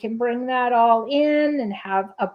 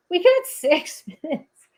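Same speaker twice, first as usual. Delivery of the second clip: repeated with a real laugh breaking in; laughing through it; 5 semitones higher than usual. Real, not acted. Telling viewers the time left, laughing.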